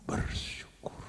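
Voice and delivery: barely voiced whisper of Bersyukur. Shown as a demonstration. Preacher showing the wrong, quiet thanks. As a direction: breathe Bersyukur out with no voice. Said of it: "kalau ngomongnya bersyukur, namanya nggak nyaring".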